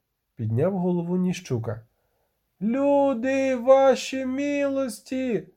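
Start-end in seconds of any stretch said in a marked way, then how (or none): 2.6-5.5 high, drawn-out cry for attention; breathless yelling. A narrator's reading. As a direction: call out high and stretched to the masters. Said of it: "дихав швець". (протяжний високий вигук)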